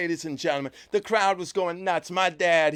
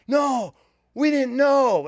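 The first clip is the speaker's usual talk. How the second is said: excited insistence; 7 semitones higher, 6 dB louder, slower than usual. Retold or imitated. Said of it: "And they go".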